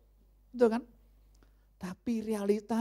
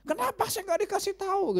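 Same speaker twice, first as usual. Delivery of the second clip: angry neighbour; 14 semitones higher than usual. Retold or imitated. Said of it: "Dia marah-marah".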